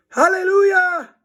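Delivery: high, loud relieved cheer; joyful cry. Acted. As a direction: shout for joy at being saved. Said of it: "cried Harold".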